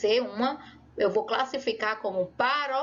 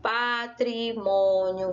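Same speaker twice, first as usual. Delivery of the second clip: "Patrimônio" slowly, in drawn-out syllables; dictation style; much slower than usual. Shown as a demonstration. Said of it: "separando cada uma delas, assim, ó".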